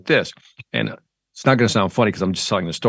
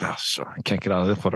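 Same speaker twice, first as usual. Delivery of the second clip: low, softer grumble to oneself; resigned aside; 5 semitones lower, 8 dB quieter than usual. Retold or imitated. Customer realizing he's stuck with the purchase, resigned aside. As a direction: drop down into a defeated private aside.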